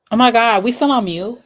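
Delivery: sudden fear of being muted; alarmed. Real, not acted. Panic over stream mute.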